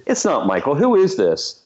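retold disbelief. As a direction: re-enact doubting a prank caller.

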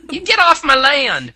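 loud high hillbilly yell. Pretend. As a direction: shout him off the property. Mock hillbilly threat.

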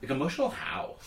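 high, breathy worried question. Retold or imitated. Husband asking the nurse what she means, worried high voice. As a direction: ask with sudden worry. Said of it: "I'm like".

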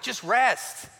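lightly voiced wife urging him; wife's nudge. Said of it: "My wife's all the time telling me".

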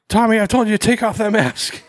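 put-on high-pitched scolding of a kid; scolding receptionist.

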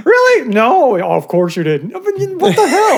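high, laughing incredulity; hammed-up exasperated protest; mock disbelief. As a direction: play up the scandalized reaction. Acted disbelief at a rumor.

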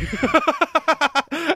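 high burst of laughter; loud laugh. Real, not acted. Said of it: "I can't stop laughing".

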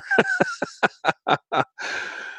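sudden high-pitched laugh; high squealing laugh. Real, not acted. Raffle software stalls.

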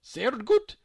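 high, loud German "Sehr gut"; pleased old woman. A narrator's reading. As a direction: approve with sudden warmth.

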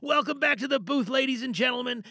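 bright, loud announcer opening with a lift; show host welcome. Acted. Host greeting the booth audience, loud announcer welcome.

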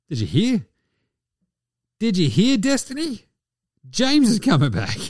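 mock excited gossip, pitched high; excited stripper gossip. Strippers telling Destiny that James is coming back, excited. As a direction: pitch it up like sharing big news with a friend.

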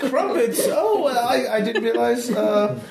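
very high, hesitant hostess voice; flustered mother character. Acted. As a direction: stammer politely in a high matronly voice. Game master plays Bertha.

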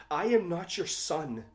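loud, high scream of denial. Acted. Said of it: "Cassandra screams".

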